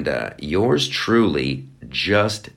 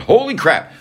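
holy jumps high, sudden burst; a sudden high holy crap; surprised exclaim; 11 semitones higher, 5 dB louder than usual. Real, not acted. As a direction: blurt it out in shock.

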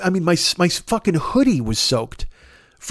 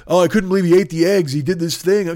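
whiny fan mimic. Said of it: "mocking angry fans".

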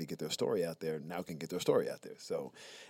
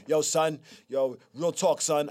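New Yorker; 7 semitones higher, 5 dB louder, slower than usual. Retold or imitated. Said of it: "New Yorkers ... talk about".

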